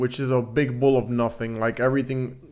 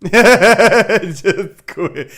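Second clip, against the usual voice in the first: laughing repeat, louder and higher; 6 semitones higher, 8 dB louder than usual. Real, not acted. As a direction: crack up while saying it. Laughing at the letter he read.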